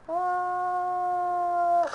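high, loud drawn-out oh; surprised. Real, not acted. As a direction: caught off guard by the jump. Oyunda şaşırtıcı an. (yüksek ve uzun şaşkın "oo")

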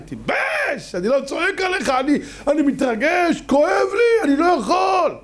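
high, anguished shouting, half crying; father crying out in pain. Acted. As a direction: plead at your son in an overwrought high wail. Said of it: "אבא בוכה". (אבא זועק בכאב, על סף בכי)

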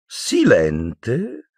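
drawn-out, rising, greedy question; avid villainess. A narrator's reading. Umbridge hearing of Silente, avid drawn-out question. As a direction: pounce on the name, swooping up. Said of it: "sussurrò avida la Umbridge".

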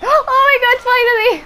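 high, loud gasping exclamation; thrilled relief. Real, not acted. Player finally spotting the awaited bird, thrilled relief. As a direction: burst out with sudden, high-pitched delight.

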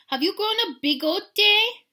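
very high, loud, drawn-out question; tiny dog. A narrator's reading. Smallest dog asks about moustache, high loud voice. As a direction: quiz him in a little high voice. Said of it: "the smallest of the small ones".

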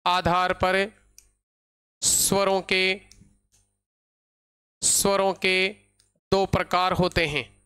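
slow, repeated phrases with long pauses; measured phrase-by-phrase classroom dictation. Real, not acted. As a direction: dictate slowly so students can write.

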